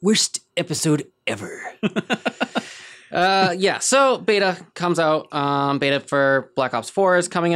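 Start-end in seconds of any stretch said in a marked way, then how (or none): 0.0-1.6 lightly voiced pompous nerd; a hint of cartoon snob; dry mock-nerd pronouncement; Comic Book Guy. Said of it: "with comic book guy voice".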